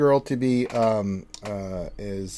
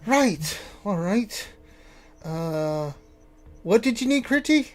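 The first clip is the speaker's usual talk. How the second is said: higher-pitched, distracted tinkerer; raised voice of a preoccupied tinkerer; absent-minded tinkerer; 9 semitones higher, slower than usual. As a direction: play a busy inventor caught mid-task. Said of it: "he's like".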